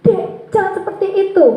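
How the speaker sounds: high-pitched scolding, acted out; mock angry teacher. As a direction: imitate a teacher snapping at a child. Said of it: "tidak langsung marah". (pura-pura marah, nada tinggi)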